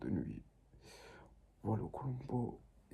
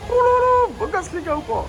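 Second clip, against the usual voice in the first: mock self-admiring squeal; 4 dB louder than usual. Admires himself on camera.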